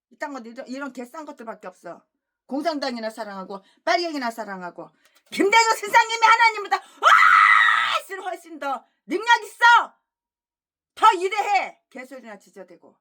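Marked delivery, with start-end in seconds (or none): 5.3-11.8 mock tearful shout. Mocking the rival pastor's claim.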